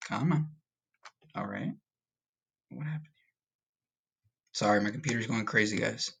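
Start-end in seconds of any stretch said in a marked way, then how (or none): 0.1-3.3 barely audible, puzzled aside; whispered muttering. Real, not acted. Teacher puzzles over computer going crazy, whispered muttering. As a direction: whisper it to yourself.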